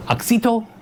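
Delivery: Oxytow said AHK-see-toe, held high; high questioning AHK-see-toe for Oxytow; questioned pronunciation. Retold or imitated. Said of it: "how do you pronounce this?".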